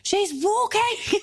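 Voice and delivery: high-pitched mock yell up the stairs, no real volume; imitated excited shout. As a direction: call out like an excited mum, drawn out. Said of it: "my mum starts shouting upstairs".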